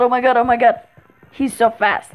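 ordinary talk

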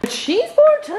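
high, bright announcement; excited squeal. Real, not acted. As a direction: squeal it out with glee.

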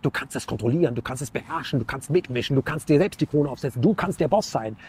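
low, soft coaxing voice in the ear; whispering tempter. Retold or imitated. The evil voice tempting him, low soft coaxing murmur. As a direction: purr the temptations low and soft like a devil on the shoulder. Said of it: "wer säuselt mir was da ins Ohr".

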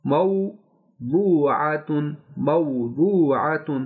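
मौदूआतुन slowly, clear "mau"; drawn-out syllables, मौदूआतुन with open "au"; tajweed demo. Shown as a demonstration. Teacher drills students on मौदूआतुन's clear "mau". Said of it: "मीम से पहले ज़बर है तो मऊ".

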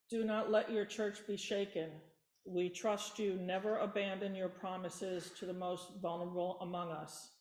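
ordinary delivery

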